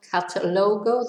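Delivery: cata-LO-go, weight on LO; misplaced stress. Shown as a demonstration. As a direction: say catalogo wrongly, stressing the third syllable. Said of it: "they won't understand".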